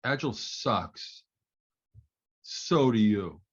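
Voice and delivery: slow, blunt, lightly imitated Russian accent. Architect turns on the coach in the washroom, slow mock Russian accent. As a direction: land each short phrase slowly, with a hint of Russian. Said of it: "in a thick Russian accent".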